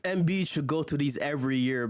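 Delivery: normal talking voice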